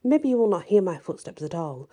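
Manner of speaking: ordinary delivery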